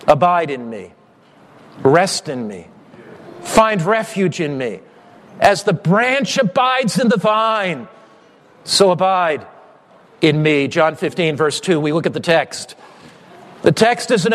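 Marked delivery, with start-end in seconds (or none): 0.1-10.7 tender invitation. Acted. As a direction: invite them gently to rest. Soft invitation after shouting.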